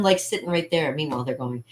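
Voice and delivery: ordinary talk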